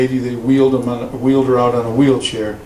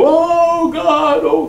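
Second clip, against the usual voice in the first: high, louder imitation of wailing prayer; mock wailing; 13 semitones higher, 3 dB louder, much slower than usual. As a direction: wail like the people in the pews. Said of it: "all this screaming and wailing".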